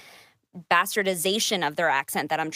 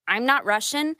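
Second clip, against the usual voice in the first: normal talking voice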